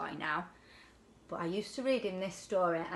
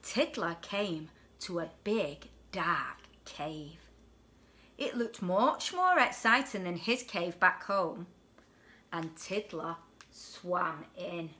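put-on lively storybook voice for a child; bedtime story reading; 3 dB louder than usual. A narrator's reading. Parent reading a picture book to children, lively. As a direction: read it like a bedtime picture book to a little one.

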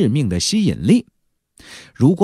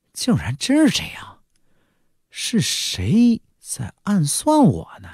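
stunned realization; 8 semitones higher than usual. Acted. Hiding underwater, Zhang Fan realizes the trap, stunned. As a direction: let it dawn on him, puzzled.

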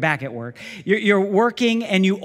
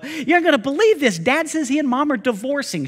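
higher, stunned; shocked son; 9 semitones higher, 5 dB louder than usual. Retold or imitated. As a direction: break the news in disbelief. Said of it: "He calls her up".

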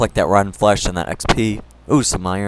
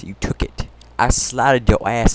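ordinary talk, nothing marked

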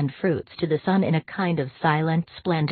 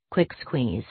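usual speaking voice

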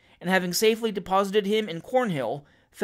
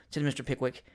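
ordinary delivery

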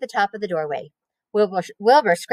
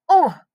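grunt; 9 semitones higher, 5 dB louder than usual. Acted. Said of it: "he grunted".

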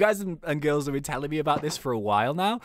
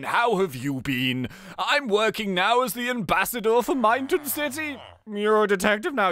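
posh; 2 semitones higher than usual. Acted. Sir Don greeting an old school friend, posh. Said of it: "He sounds really posh".

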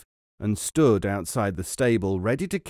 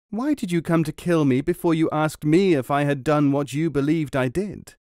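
higher, louder across the river; loud calling out; 5 semitones higher, 3 dB louder than usual. A narrator's reading. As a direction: call across the water, pleading. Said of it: "called out in a loud voice".

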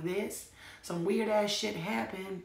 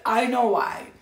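1 emphatic repeat; 4 semitones higher, 12 dB louder than usual. Real, not acted. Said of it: "I said".

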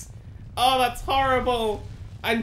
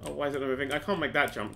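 usual speaking voice

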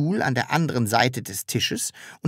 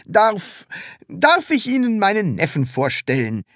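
stammering; 8 semitones higher, 3 dB louder than usual. A narrator's reading. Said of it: "stotterte Herr Taschenbier".

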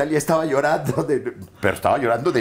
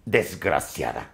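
lightly acted menacing villain, low; soap villain threat; 8 semitones lower, 5 dB quieter than usual. Actor threatening his victim in a scene, mildly menacing.

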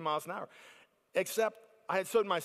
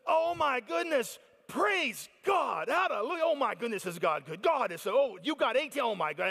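ecstatic praise; 6 semitones higher than usual. Retold or imitated. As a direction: gush praise like you can't contain it. Father rejoicing over crab catch.

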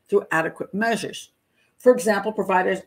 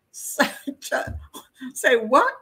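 ordinary talk

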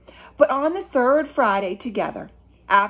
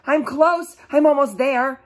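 excited build-up; 4 semitones higher, 7 dB louder than usual. Acted.

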